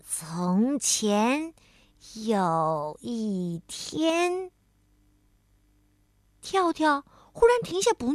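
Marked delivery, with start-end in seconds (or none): 0.1-4.5 very slow, one word at a time; reading aloud haltingly. Acted. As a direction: read like a child just learning. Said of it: "慢慢地一个字一个字地念".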